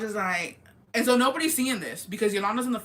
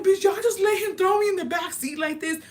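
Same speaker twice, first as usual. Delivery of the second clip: much higher, outraged phone rant; indignant complaint; 11 semitones higher, 5 dB louder than usual. Retold or imitated. Calling her friends from the back seat, high outraged complaint. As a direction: let them have it, high and betrayed.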